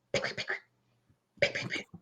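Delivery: light, quiet pecking taps; imitated tapping. Woman retells rocks at her window, imitated tapping. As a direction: imitate little rocks hitting a window, softly. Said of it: "I hear".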